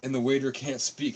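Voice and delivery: ordinary talk, nothing marked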